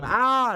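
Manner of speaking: short loud, high mock scream; demonstrative scream. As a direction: let out a brief frustrated yell. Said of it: "I'm gonna scream".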